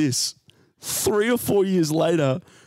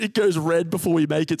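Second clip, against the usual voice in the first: ordinary talk, nothing marked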